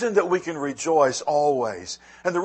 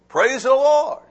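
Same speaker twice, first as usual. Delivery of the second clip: put-on kids praising; 9 semitones higher, 7 dB louder than usual. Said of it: "They would".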